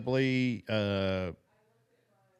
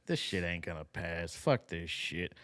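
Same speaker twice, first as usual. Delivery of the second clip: much lower, softer grumbling impression; low mock grumble; 7 semitones lower, 7 dB quieter than usual. Host mimicking the congressman's fed-up grumble, low and soft. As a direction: grumble it low under your breath. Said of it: "when he's like".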